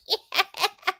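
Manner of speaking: high, airy imitated giggle; mock giggle. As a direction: giggle like the girl in the clip. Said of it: "And she just laughing".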